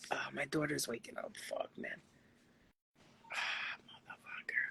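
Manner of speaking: real whisper. Real, not acted. Mother hushing as her daughter wakes, whispering. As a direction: whisper a curse under breath.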